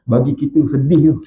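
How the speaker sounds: ordinary talk, nothing marked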